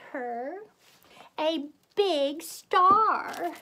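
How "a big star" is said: slow, loud, stretched-out announcement; grand flourish. A narrator's reading. Reader summing up Kermit's gift, grand flourish. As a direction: draw out each word, higher and louder.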